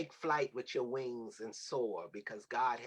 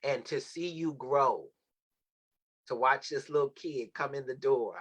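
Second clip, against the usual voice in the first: normal talking voice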